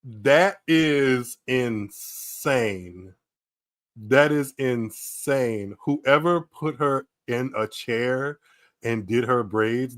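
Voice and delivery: very slow, word by word; slow, deliberate pronouncement of outrage; aghast, drawn-out verdict. Real, not acted. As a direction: stretch every word in disbelief. Aghast judgement on the braids.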